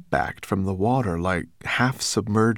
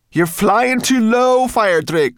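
high, drawn-out loud shout; slow high-pitched yell; urgent call; 9 dB louder than usual. A narrator's reading. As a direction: call out a warning to the dragon. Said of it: "called Sorrel".

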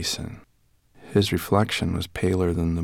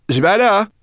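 high, loud chirpy brownie; high brownie voice, talking with her mouth full; 10 dB louder than usual. Acted. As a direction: pitch her up into a bright little creature. Sorrel calling.

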